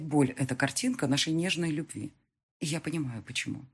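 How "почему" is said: ordinary delivery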